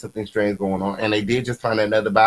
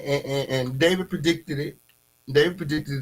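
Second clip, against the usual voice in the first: ordinary talk, nothing marked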